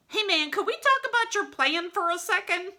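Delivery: high nervous plea to the boss; nervous sidekicks. Acted. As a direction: stall nervously. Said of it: "they're really nervous".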